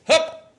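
sharp, high, loud hup like a hike call. Retold or imitated. Imitating his dad warning him, sharp high loud hup. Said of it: "His sound to me was this".

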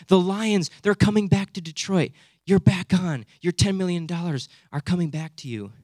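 excited good news. Acted. Breaking the news of the team's return, excited. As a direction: sell the comeback with acted excitement.

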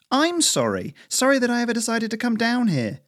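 high-pitched, indignant retort; snapped. A narrator's reading. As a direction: let the exasperation ride high. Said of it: "Lisa snapped".

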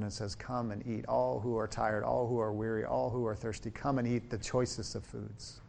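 quiet, earnest prayer voice; soft prayer. Real, not acted. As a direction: pray it gently, hushed and sincere. Opening prayer before the sermon.

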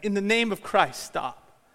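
retold yell. Monk runs down the steps at the games, yelling. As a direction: call out urgently over cheering. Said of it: "runs down the steps yelling".

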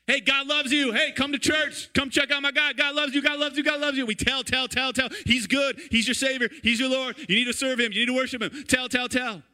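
high, insistent church pitch on repeat; pushy church evangelist. Acted. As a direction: keep hammering it out, high-pitched and relentless. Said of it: "we do what's called tell and tell".

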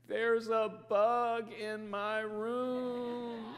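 put-on slow, wobbly, half-laughing little-girl whimper; imitating tearful daughter. Dad retelling his daughter's bug fear, slow mock whimper. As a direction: mimic a scared child near tears, drawn out. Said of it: "And she cried".

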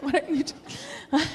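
slow, high, laughing "what are you doing"; laughing mock puzzlement. Isaac staring back at her, slow laughing mock bewilderment. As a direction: voice his baffled look while laughing.

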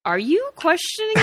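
indignant. Acted. Indignant retort.

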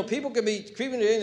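normal talking voice

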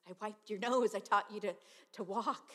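loud insistent mother's voice; mother calling out to her grown son; loud pleading mother. Acted. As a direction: push it out with a mother's passion.

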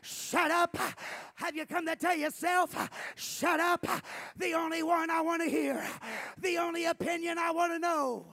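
shouted high, loud sermon opening; fiery preacher at full volume; preaching at full force. Acted. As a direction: thunder it from the pulpit.

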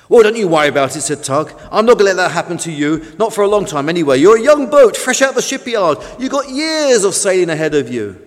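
loud, fast reassuring tug; hearty reassurance. Acted. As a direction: buck him up briskly. Tug reassures new boat.

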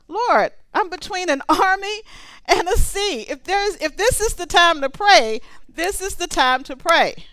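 high-pitched, exasperated plea; protesting to God. Acted.

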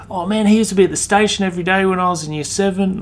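imitating an excited old fan of his; lifted, chatty mock voice; high quoted voice. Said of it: "They're like".

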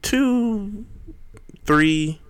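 high stretched-out boast; drawn-out brag. Real, not acted. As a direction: stretch the number out proudly. Bragging about followers.

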